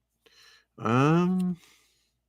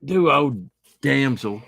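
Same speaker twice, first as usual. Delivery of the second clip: Duo Damsel said higher and louder, each word stressed; emphatic correction; 5 semitones higher, 5 dB louder, slower than usual. Real, not acted. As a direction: say the name firmly to get it right.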